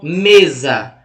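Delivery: "mesa" slow and loud, weight on ME; teacher showing ME as the strong syllable of "mesa"; stress on first syllable. Shown as a demonstration. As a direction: stretch the ME of "mesa". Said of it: "É me".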